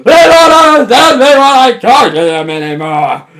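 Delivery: very high, loud mock raving; hammed-up madman wail; straitjacket screaming. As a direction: scream as if losing your mind. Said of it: "screaming into this microphone".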